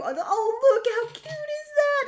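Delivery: put-on very high, squeaky, cutesy baby talk; condescending cooing. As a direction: gush over her like a pet. Mocking the patronizing person.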